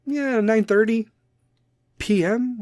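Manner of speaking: high, drawn-out mock reply; straight-faced suggestion. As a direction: act out a casual suggestion, slowly. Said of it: "coming at me with a straight face and being like".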